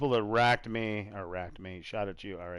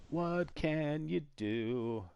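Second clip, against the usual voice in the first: very slow, soft and higher; drawn out; 4 semitones higher, 6 dB quieter, much slower than usual. Real, not acted. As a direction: stretch out each word, lightly. Drawn-out repeat of resigned remark.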